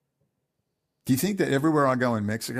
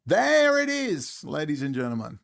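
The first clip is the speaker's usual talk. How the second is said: sarcastic announcing; 3 semitones higher than usual. Real, not acted. Sarcastic reveal in a rant.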